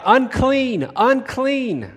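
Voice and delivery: loud high wail of Unclean, Unclean; crying out. Retold or imitated. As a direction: cry Unclean out as a leper warning others. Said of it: "cry out".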